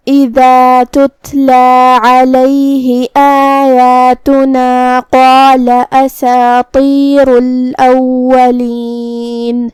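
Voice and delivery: slow, drawn-out tajweed chanting; Quran recitation. Acted. Teacher recites the verse for students, slow chant. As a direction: recite each verse slowly and melodically.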